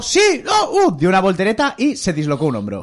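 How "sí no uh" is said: imitating a friend's yells, pitched much higher; retold victory yell. Retelling how his friend celebrated beating Jonas, mock victory yells. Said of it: "gritó".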